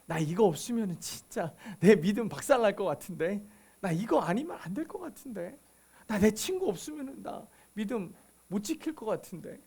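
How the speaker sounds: lightly acted worry, kept low in volume; voiced inner doubt. Preacher voicing a believer's doubts about their faith, quiet and hesitant. 신자의 불안한 속말을 대신함.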